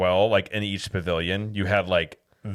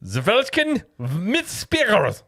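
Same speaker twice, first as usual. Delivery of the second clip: high, slow, mock-furious German; hammed-up aggressive German pronunciation; 12 semitones higher, much slower than usual. Shown as a demonstration. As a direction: attack the German words with full confidence. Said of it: "say it very aggressively and with confidence".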